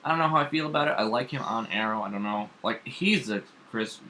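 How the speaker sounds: usual speaking voice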